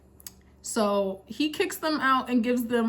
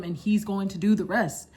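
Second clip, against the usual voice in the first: ordinary delivery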